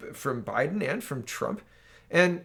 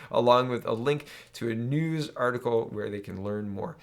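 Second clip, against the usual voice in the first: ordinary talk, nothing marked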